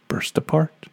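ordinary delivery, nothing marked

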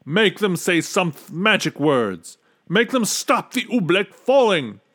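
high, loud, urgent orders; a desperate royal command; commanding king. A narrator's reading. Trapped king demands magic to stop the Oobleck, commanding. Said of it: "he commanded".

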